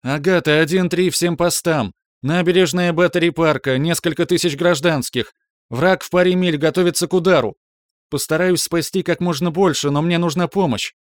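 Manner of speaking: high, loud, in one breath; urgent rapid-fire radio shout. Acted. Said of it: "на одном дыхании отчеканила своё послание в эфир". (на одном дыхании, почти крик)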